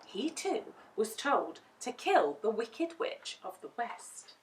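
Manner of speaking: ordinary delivery, nothing marked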